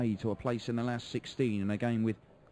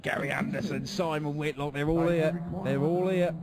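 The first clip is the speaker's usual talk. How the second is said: excited; 5 semitones higher, 5 dB louder than usual. Real, not acted. Commentator hypes the star field.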